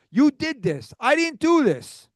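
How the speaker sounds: real exasperation, pointing the finger; agitated. Real, not acted. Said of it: "be agitated".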